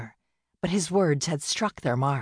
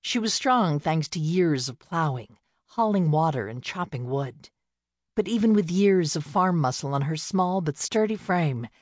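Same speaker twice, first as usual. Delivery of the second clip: ordinary delivery, nothing marked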